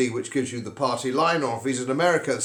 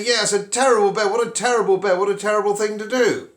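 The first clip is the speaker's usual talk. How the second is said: higher, louder chorus of agreeing friends; sympathetic friends; 6 semitones higher, 4 dB louder than usual. Acted. Friends agree with the bitten man, mock outraged. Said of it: "all your friends say".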